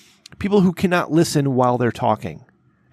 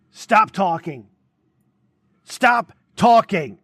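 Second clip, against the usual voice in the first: retold yell; 7 semitones higher, much slower than usual. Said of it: "And I yelled and I said".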